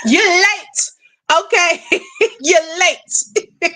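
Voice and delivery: chuckling through the words; amused, laugh breaking into the line. Real, not acted. Laughs while greeting late viewers.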